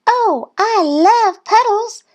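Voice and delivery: very high, drawn-out little-duck yell; gleeful yell. A narrator's reading. Thistle running ahead to puddles, high gleeful yell. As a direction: squeal it out slowly and high. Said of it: "Thistle yelled and ran ahead".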